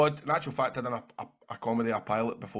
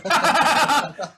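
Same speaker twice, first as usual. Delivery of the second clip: burst of laughter; 12 semitones higher, 9 dB louder than usual. Real, not acted. Laughing at his own impression.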